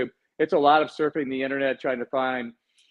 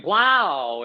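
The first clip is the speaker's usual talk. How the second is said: loud, higher baseball announcer exclamation; game show announcer; 5 semitones higher, 4 dB louder than usual. Shown as a demonstration. Co-host demonstrating his introduction voice, game show announcer. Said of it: "like a game show baseball announcer".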